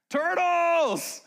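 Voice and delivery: loud, very high drawn-out scream. Retold or imitated. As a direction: scream it out like an excited beachgoer. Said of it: "someone screams".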